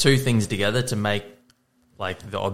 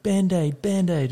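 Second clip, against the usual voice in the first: put-on whinging toddler; 8 semitones higher, slower than usual. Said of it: "whinging about his finger".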